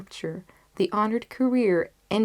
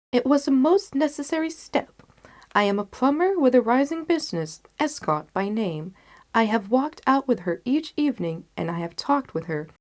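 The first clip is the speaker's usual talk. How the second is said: higher, louder confident detective; Holmes briskly explaining; 6 semitones higher, 5 dB louder than usual. Acted. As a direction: lay out the plan briskly. Holmes voiced in story.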